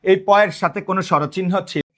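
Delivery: ordinary talk, nothing marked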